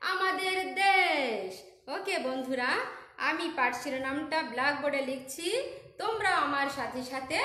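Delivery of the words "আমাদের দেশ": drawn out, loud title; slow announcement. Shown as a demonstration. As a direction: stretch each word for the class. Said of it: "পাঠ শিরোনাম হচ্ছে". (ধীরে টেনে ঘোষণা)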